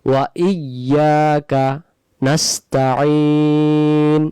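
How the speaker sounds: নাস্তাইন drawn out long; slow recitation. Shown as a demonstration. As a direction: chant ওয়া ইয়াকা নাস্তাইন slowly. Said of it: "তারপর". (ধীরে তিলাওয়াত)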